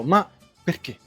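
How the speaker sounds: normal talking voice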